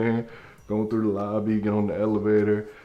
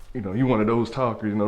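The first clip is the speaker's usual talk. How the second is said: usual speaking voice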